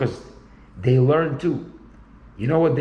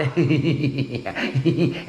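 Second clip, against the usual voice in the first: a forced, hollow laugh to show how they laugh; fake laugh. Shown as a demonstration. Mimicking their fake laugh for the listener, forced laughing. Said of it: "And you laugh like this".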